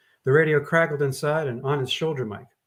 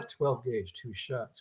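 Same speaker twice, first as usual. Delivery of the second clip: much softer, low muttering; to himself; 3 semitones lower, 9 dB quieter, much slower than usual. Acted. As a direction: say it under his breath. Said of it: "Gordon told himself".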